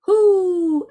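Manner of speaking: high, loud, drawn-out hoots; owl's hoot. A narrator's reading. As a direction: hoot like an owl, sliding gently down. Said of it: "asked the owl".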